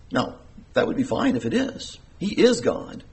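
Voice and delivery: ordinary talk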